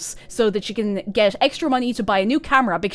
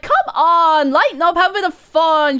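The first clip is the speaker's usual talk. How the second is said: high, loud teasing; 9 semitones higher, 10 dB louder than usual. Retold or imitated. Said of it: "And Stella's like".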